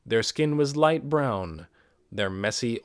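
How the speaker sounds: ordinary delivery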